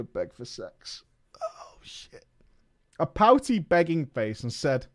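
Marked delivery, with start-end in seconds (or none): 0.0-2.2 unvoiced horrified whisper; real hushed panic. Real, not acted. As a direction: breathe it in alarm. Realising he will beg.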